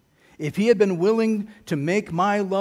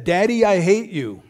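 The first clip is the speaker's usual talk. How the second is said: put-on a child's hate shouted out louder; angry child; 3 semitones higher, 7 dB louder than usual. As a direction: imitate the furious son, raised voice. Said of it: "He got so mad at me that he said".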